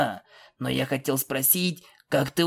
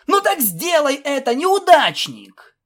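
yelled dare; 13 semitones higher, 6 dB louder than usual. A narrator's reading. Filly dares her friend to do it, yelling. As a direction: shout the dare and stretch the insult.

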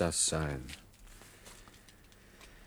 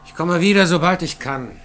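laut und hoch, über den Lärm hinweg gerufen; laut rufen; 9 semitones higher, 10 dB louder than usual. Acted. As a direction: ruft dem Kollegen im Weggehen zu. Ruf quer durch den Saal. (Hans ruft dem Kollegen laut durch den Maschinensaal zu)

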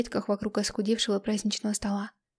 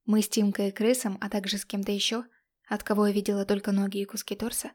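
usual speaking voice

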